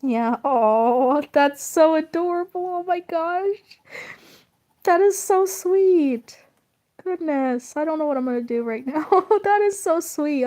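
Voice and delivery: high-pitched, loud gushing with laughter breaking in; gush, moved and delighted, voice climbing high; happy emotional. Real, not acted. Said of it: "I get really emotional ... happy emotional".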